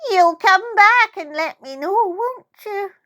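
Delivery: put-on high, pleading old lady; pleading old woman.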